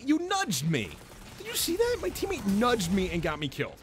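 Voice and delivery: high, loud exasperated protest; indignant outcry. Real, not acted. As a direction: gamer yells that his teammate nudged him into death. Teammate got him killed.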